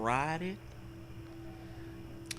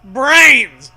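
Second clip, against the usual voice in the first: loud, higher zombie groan of a word; zombie cry; 6 semitones higher, 15 dB louder than usual. Acted. Zombies answer the screaming pair, loud. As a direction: bellow it like a hungry zombie. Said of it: "the zombies reply".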